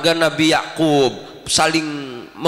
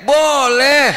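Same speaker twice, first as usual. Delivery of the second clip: slow, loud, high correction; emphatic divine reply; 7 semitones higher, 3 dB louder, slower than usual. Acted. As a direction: declare it with full authority. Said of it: "Apa kata Allah?". (tegas dan lantang)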